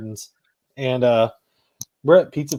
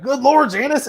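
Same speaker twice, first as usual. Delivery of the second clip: high-pitched movie-quote exclamation; exasperated catchphrase; 10 semitones higher than usual. Retold or imitated. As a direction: cry it out in mock exasperation, high on LORD. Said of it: "you know how in the tone".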